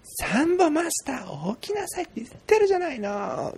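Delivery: 裏声でゆっくり伸ばす母親の真似; 何度も起こす母親を大げさに演じる; しびれを切らす母親. Retold or imitated. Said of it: "みたいな感じでね". (高い声で小言を言う母親のまね)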